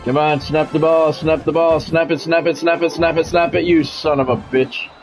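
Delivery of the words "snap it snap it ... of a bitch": ordinary talk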